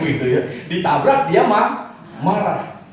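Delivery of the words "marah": ordinary talk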